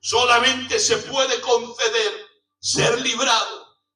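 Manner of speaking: loud, high preaching at full force; fiery preaching. Acted. Preacher proclaiming grace to his congregation, fiery preaching. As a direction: hammer the message home to the congregation.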